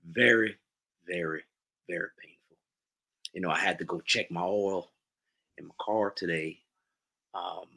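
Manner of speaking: sorrowful Southerner, taking his time; Southern accent. Acted. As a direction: tell a sad tale in a put-on Southern accent. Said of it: "in your best Southern accent".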